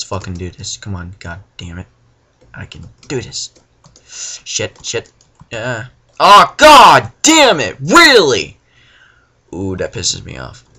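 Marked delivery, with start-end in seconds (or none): 6.2-8.6 loud, high, drawn-out raging yell; real rage at the screen; furious shout. Real, not acted. Player dying again, shouting furiously at the game.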